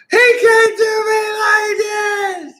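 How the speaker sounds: very high, drawn-out mock wail; mimicked screaming and crying. Imitating the girl refusing the car, high screaming wail. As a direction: bawl it slowly in falsetto. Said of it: "She's screaming and crying".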